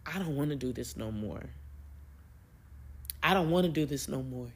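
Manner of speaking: low, soft, discouraged admission; insecure. Retold or imitated. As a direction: quietly give up, retelling a moment of self-doubt. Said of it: "I got so insecure and I'm like".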